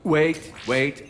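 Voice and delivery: sudden alarm. Acted. Commander stopping the AI's guest list, alarmed. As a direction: jump in to stop them. Commander cuts off party invitations.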